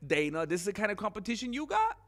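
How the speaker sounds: mock gloating jab; gloating taunt. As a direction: gloat through a laugh. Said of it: "he's gonna go".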